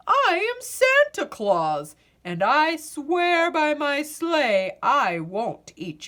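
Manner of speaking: Santa Claus voice. A narrator's reading. Santa explaining to the pirates why he came, hearty giant voice. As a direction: play the huge gentle Santa.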